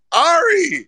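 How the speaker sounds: calling out. Real, not acted. Calling out to Ari.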